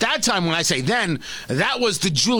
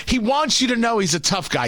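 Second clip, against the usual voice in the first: ordinary delivery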